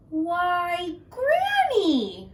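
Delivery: high wondering "why Granny"; little girl puzzled; surprised girl. Acted. Little Red voiced.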